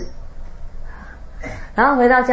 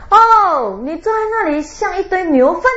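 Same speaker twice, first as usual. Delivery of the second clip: 1 gleeful taunt; 8 semitones higher, 3 dB louder than usual. Retold or imitated. Su mocks the monk, gleeful taunt.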